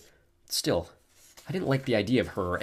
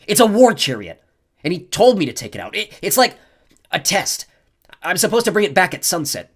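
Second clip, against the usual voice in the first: Clarice's voice; 10 semitones higher, 10 dB louder than usual. A narrator's reading. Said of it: "Clarice growled".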